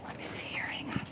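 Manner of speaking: soft unvoiced whisper. Real, not acted. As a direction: whisper while rummaging. Whispering while digging.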